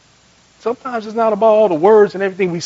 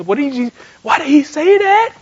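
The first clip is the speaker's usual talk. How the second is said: high, raised-voice baffled question; acted incredulity; 8 semitones higher than usual. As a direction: act out shocked disbelief. Acts out disbelief.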